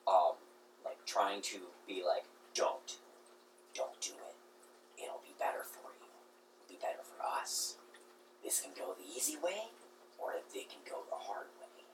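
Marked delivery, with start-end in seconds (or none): 2.5-11.8 whispered nagging rat voice. Acted. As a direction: hiss it softly like a tempting rat. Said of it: "nagging voice that's very familiar".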